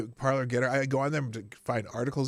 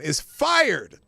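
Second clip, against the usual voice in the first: fired punched loud; 「fired」を強く言う; stress on fired; 5 semitones higher, 6 dB louder than usual. Shown as a demonstration. Trending word read out.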